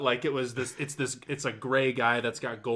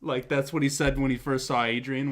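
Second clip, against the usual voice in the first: normal talking voice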